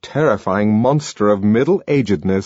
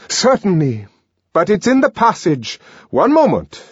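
raised, quick, confident reply; Bernard eager; 10 semitones higher, 4 dB louder than usual. A narrator's reading. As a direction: keep the upper hand. Bernard voiced.

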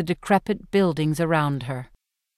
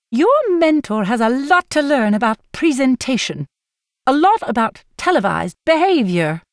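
prim, higher fussy escort voice; disgruntled Effie; 5 semitones higher than usual. A narrator's reading. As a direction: sniff at the mentor's manners. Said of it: "Effie Trinket is disgruntled".

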